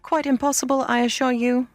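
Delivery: high, airy refusal; brisk village girl turning them down; prompt refusal. A narrator's reading. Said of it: "the girl answered promptly".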